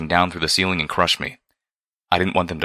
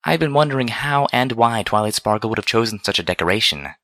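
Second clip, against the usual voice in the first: higher, refined wondering; musing; 5 semitones higher than usual. A narrator's reading. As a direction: wonder aloud in a lady's lifted voice. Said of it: "Velvet's voice mused".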